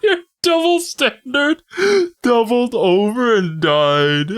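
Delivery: laughing quote. Retold or imitated.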